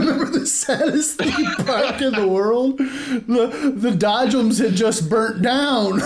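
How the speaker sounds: real giggling recollection; laughing. Real, not acted. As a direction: laugh through the memory of the burnt Dodgems. Laughing recalling sad theme park.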